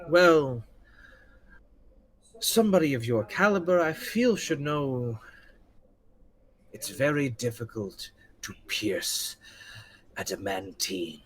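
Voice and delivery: deliberate pauses, veiled threat at the end; refined general, condescending. Acted. Game master voices the refined general.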